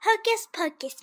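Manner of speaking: high, punched magic words; spell casting. A narrator's reading. Anansi commanding the magic stick, high-pitched incantation.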